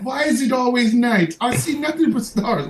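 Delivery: high, dreamy voice of a woman seeing stars; hammed-up swooning lady; mock dazed woman. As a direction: play her giddy and bewildered. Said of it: "she's gonna see stars".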